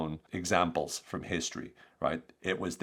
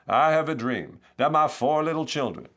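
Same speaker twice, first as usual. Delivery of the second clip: Martin Luther King; 7 semitones higher, 6 dB louder than usual. Acted. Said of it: "Forgive the, the accent".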